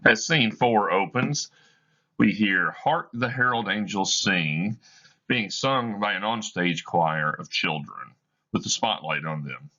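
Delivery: normal talking voice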